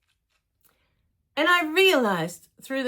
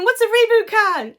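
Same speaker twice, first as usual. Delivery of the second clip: baffled question; 11 semitones higher, 9 dB louder than usual. Retold or imitated. Mom confused during game.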